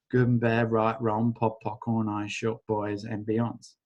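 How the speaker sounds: tossed-off chant, dropping away; quick mumbled incantation. Acted. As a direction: rote clearing phrase, murmured. Recites clearing statement.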